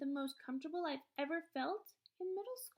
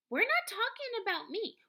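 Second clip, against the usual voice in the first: higher and louder protest; a teacher defending his students, raised voice; heated retort; 10 semitones higher, 7 dB louder than usual. A narrator's reading. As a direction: push back hard. Teacher argues back.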